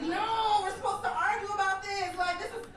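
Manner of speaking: high, loud, exasperated protest; hammed-up outraged whine; pissed off. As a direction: plead for a fight, worked up and indignant. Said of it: "I was so pissed the fuck off".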